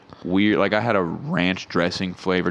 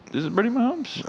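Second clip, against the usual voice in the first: sharply higher rising question; shocked disbelief. Retold or imitated. Seeing a photo of Brittany Mahomes, high shocked disbelief. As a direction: gasp it out in disbelief. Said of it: "I was like".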